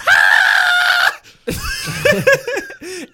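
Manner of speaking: high shrieking laugh. Acted. Imitating Aerosmith singer.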